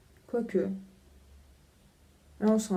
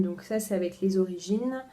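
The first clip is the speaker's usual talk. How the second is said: usual speaking voice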